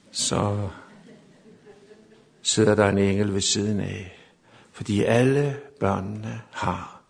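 low, slow, reassuring delivery; hushed tender preaching. Real, not acted. Preacher assures parents angels watch sleeping children, hushed tender preaching. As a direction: settle into a gentle, lingering bedtime tone. Gentle close of sermon point.

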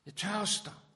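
high, loud cry of thirst; crying out again. Acted. Jesus on the cross repeating his cry, loud and high. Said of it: "råbte han".

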